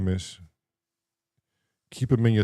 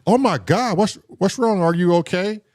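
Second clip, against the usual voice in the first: higher, worried teacher voice; concerned teacher; 8 semitones higher than usual. Retold or imitated. Teacher checking on the kid, higher and concerned. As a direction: play the teacher rushing over, alarmed. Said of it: "and she said".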